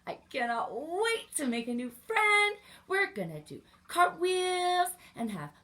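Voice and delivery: put-on high, loud child voice full of plans; little bear girl skipping off, thrilled; excited little girl. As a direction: rattle off the fun ideas like a giddy kid. Lucy sets off on her search.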